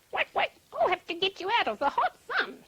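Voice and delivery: high, quacking rescuer; lifted duck character voice. A narrator's reading. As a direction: quack briskly like a helpful duck. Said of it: "said the duck".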